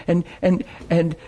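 normal talking voice

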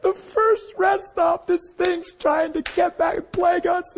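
high-pitched tearful whine, imitated; crying. Husband explaining his lapse to his wife, crying. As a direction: whimper it far above speaking pitch. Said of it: "how hard I was crying".

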